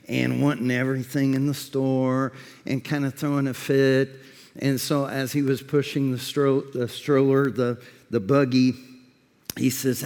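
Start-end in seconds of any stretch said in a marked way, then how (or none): none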